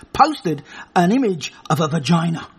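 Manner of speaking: ordinary talk, nothing marked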